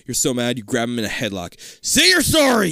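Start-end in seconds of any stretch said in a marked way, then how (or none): 1.8-2.7 high loud demand; yell. Acted. Big brother yelling at Denny in a headlock, high and loud. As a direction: yell it in his face. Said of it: "you yell".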